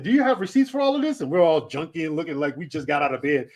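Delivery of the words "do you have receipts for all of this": higher, fast, imitating a suspicious cop; quick official questioning, pitched up and mocking; a cop questioning looters, lightly mocked. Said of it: "The cops came by and said".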